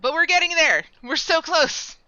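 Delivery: high, loud burst of cheer; excited. Real, not acted. Streamer cheers the viewers on about the chocobo, real excited shout.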